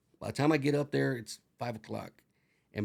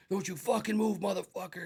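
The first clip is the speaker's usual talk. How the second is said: cop barking orders; 7 semitones higher than usual. Retold or imitated. Cop raid retold.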